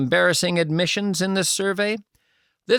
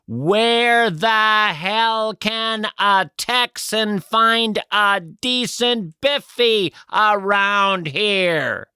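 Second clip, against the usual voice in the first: very slow, louder over-enunciated tourist English; 6 semitones higher, slower than usual. Acted. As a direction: spell it out slowly to a foreigner. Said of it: "I'm saying it slowly and I'm saying it loudly".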